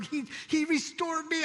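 excited. Retold or imitated. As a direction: blurt it out with joy. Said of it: "I'm just going".

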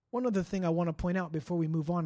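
ordinary delivery

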